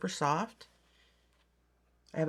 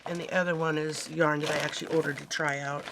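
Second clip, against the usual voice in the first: ordinary talk, nothing marked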